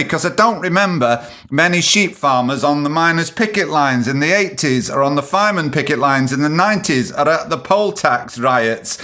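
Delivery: ordinary talk, nothing marked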